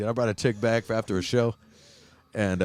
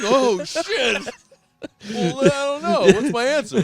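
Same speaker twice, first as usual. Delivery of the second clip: laughing panic; 13 semitones higher, 5 dB louder, slower than usual. Retold or imitated. Panicked high voice while laughing.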